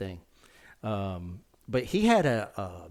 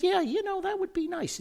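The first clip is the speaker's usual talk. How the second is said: high eager reply; 3 dB louder than usual. Retold or imitated. Young self accepting invitation, high.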